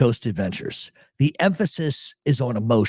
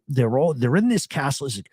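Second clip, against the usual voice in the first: normal talking voice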